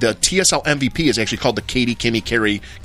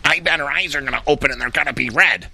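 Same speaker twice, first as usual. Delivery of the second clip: lightly voiced know-it-all friend; a hint of a smug spoiler; Katie Weiser voice; 2 semitones higher than usual. Friend spoiling the movie ending, in a lightly mimicked smug voice. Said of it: "in her Katie Weiser voice".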